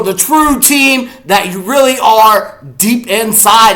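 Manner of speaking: loud, high hype-man delivery; big, slow, emphatic trash talk; pre-game hype speech. Acted. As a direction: fire up the fans with drawn-out, punched words. Hyped pre-game address to camera.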